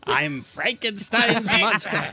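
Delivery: slow, drawn-out comic impression; Stan Lee. Podcaster auditions a celebrity as Frankenstein's monster, comic impression. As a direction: play the monster in a slow put-on voice. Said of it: "Stan Lee".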